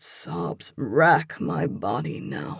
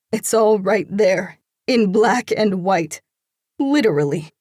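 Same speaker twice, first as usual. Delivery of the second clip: higher, louder hurt woman; upset woman's voice; 5 semitones higher, 7 dB louder than usual. A narrator's reading. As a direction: push him away, voice raised in hurt. Upset woman confronts lover.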